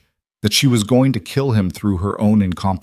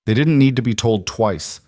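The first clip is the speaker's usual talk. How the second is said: ordinary delivery, nothing marked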